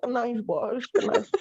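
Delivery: high "kuh-naif" for knife; mocking "knife" with the K sounded; mock mispronunciation. Imagined foreigner asking the boss, knife as "kuh-naif". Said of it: "tapi nggak ada bule bilang".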